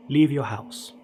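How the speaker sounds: plain reading voice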